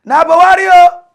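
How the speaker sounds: high, loud rally cheer; hammed-up campaign shout; mock crowd shout. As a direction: yell it like a party supporter. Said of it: "they go shout".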